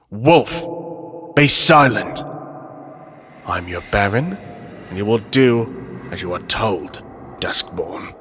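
deep, angry vampire lord, sinking to a hiss; a Baron putting a wolf in his place; deep inhuman anger, fading to a hushed threat; echoing inhuman command. Acted. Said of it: "his voice echoes ... depth and an anger".

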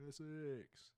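broad mock Essex accent. Says county name after Kai.

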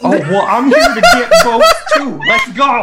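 real laughter through the words; laughing. Real, not acted. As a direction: blurt it while cracking up. Player laughing mid argument.

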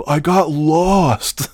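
high, slow whiny voice; hammed-up high sheepish whine; mock excuse. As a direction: drag it out like a sulky kid. Coworker's lame excuse, mocked.

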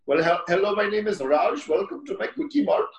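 hammed-up Indian shopkeeper accent; mock Indian accent. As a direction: greet customers in a put-on Indian accent. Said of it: "it was the same exact thing".

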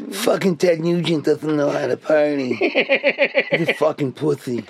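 slowed, laughing impression of a rowdy party buddy; imitating Rat. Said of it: "They're doing Rat".

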